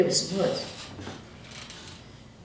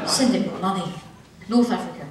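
ordinary talk

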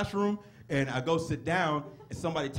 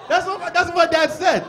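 loud, high comic disbelief; incredulous outburst; 11 semitones higher, 10 dB louder than usual. Acted. Son telling the crowd what dad said, hammed-up disbelief. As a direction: shout it as if scandalised. Incredulous after dad's remark.

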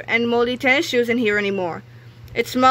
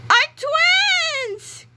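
high, breathy shocked gasp; startled boy realizing the danger; gasped realization; 3 dB louder than usual. Acted. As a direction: gasp it as the truth dawns. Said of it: "gasped George".

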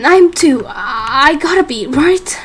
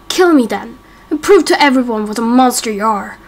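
ordinary delivery

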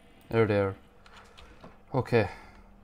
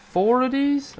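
loud, high incredulous question; 13 semitones higher, 6 dB louder than usual. Real, not acted. Player counting the phantoms against him, incredulous. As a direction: jump up in pitch in disbelief.

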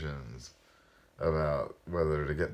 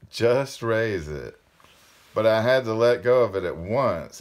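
emphatic, projected read-aloud; story narration; 3 semitones higher, 5 dB louder than usual. A narrator's reading. Reader telling of the diamond-hard flower, emphatic storytelling. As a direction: narrate with weight on the key words.

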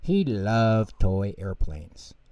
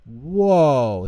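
amazed whoa; 5 semitones higher, 7 dB louder than usual. Acted. Pip and Pop amazed.

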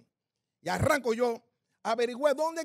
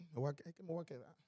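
muttered worry; 6 semitones lower, 17 dB quieter than usual. Retold or imitated. Anxious thoughts in line.

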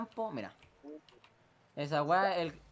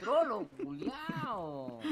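slow, drawn-out softer whine; exasperated groan; 5 dB quieter, much slower than usual. Real, not acted. Player cursing trolling teammate, drawn-out groan. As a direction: drag out each word in despair.

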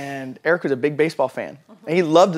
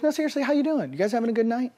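high, cheerful pressing; friendly insistence; 9 semitones higher, 3 dB quieter, faster than usual. Retold or imitated. Eric pressing the gruff strangers, high and cheerful. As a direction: pitch it up and keep it friendly. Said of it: "he goes".

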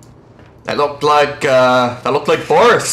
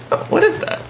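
high, unvoiced, puzzled squeak on "what is that"; curious squeak; 4 dB quieter, faster than usual. Real, not acted. Gamer spotting a strange object, squeaking "what is that". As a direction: ask "what is that" in a tiny breathless high voice.

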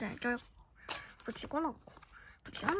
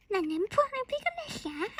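Piglet voice; 11 semitones higher than usual. Pretend. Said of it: "이러면서 놀 수 있어".

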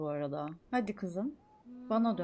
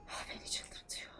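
whisper; 16 dB quieter than usual. Real, not acted. Frustrated at game character.

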